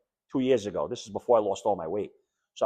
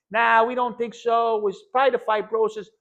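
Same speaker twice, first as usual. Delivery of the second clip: dismissive doctor; 10 semitones higher, 6 dB louder than usual. Retold or imitated. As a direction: wave the question away like an unbothered doctor. Doctor dismissing covid as cause.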